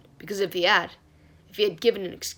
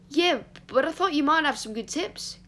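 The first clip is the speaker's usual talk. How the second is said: higher-pitched, eager and undeterred; relentlessly cheerful; 7 semitones higher, 4 dB louder than usual. A narrator's reading. Christopher pressing a weary Remus for tips, relentlessly cheerful. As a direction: keep it bouncy and upbeat. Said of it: "relentlessly cheerful".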